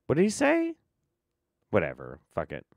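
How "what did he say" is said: squeaky voice. Acted. Squeaky mock question.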